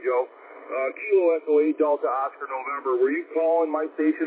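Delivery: usual speaking voice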